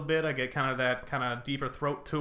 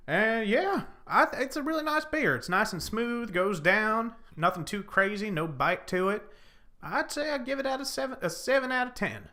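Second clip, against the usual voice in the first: put-on Southern accent; 6 semitones higher than usual. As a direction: play the good old Southern boy reviewing beer. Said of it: "it's so bad. I can do that so well".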